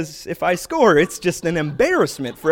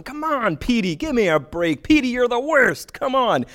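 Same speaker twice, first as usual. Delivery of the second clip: playful higher-pitched heckle; mock yell; 8 semitones higher than usual. Skating up to tease the goalie Petey, a playful mock yell. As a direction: razz the goalie in jest, pitched up. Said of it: "I'll yell at him".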